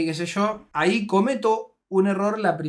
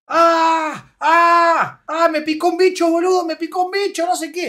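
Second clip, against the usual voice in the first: high pained shouts, much louder; retold screaming; 10 semitones higher, 8 dB louder than usual. Friend stung by a bug in the night, screaming in pain. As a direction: yell like you just got stung. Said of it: "empieza a gritar el demente".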